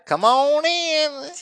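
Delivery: slow high welcoming drawl; inviting. Retold or imitated. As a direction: invite them in, slow and sweet. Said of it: "It's like".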